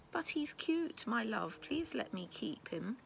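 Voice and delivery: high sweet voice begging her husband; pleading. Acted. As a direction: coax him like a pleading wife, pitched high. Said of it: "entreated her husband with big tear-filled eyes".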